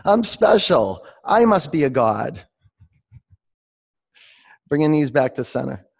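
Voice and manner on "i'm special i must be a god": high, loud voice of a conceited cat, easing down at the end; self-important cat. Acted. Teacher tells the class what the cat says, playfully self-important. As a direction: proclaim it like a cat, high on SPECIAL. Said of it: "And then the cat says".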